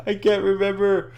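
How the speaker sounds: high-pitched hammed-up wail; mock bawling. Elmo loses the memory again, mock bawling. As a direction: wail it like a pretend crybaby. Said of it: "starts bawling".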